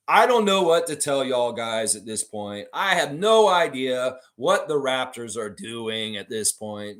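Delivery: drawn-out, overwrought lament; mock angst. Host mimicking Mike Roach's upset post, mock angst. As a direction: imitate an anguished poster, dragging out the words. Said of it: "he's, like, all in angst".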